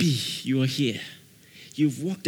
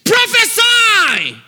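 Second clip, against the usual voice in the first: long, drawn-out shout, far higher; preacher's shout; 11 dB louder, slower than usual. Real, not acted. Preacher commands the congregation to prophesy, roaring. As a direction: roar the command and hold it. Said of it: "you're going to have to prophesy".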